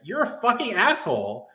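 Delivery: ordinary delivery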